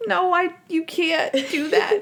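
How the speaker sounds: imitating a high-pitched objection; hammed-up, high flustered refusal; flustered protest. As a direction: protest in a mock-prissy high voice. Monk protesting a crude remark.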